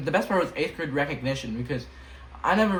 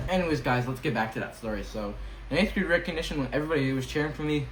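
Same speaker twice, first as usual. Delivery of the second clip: normal talking voice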